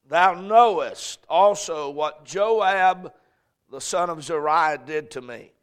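solemn Bible reading; scripture reading. Acted. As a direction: read the verses with weight. Reads scripture aloud.

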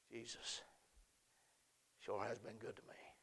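hushed sincere testimony; soft intimate close. Acted. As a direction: lean in and confide it quietly.

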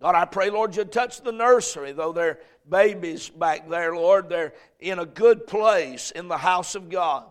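fervent pastor's prayer. Acted. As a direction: pray over the congregation. Leads congregational prayer.

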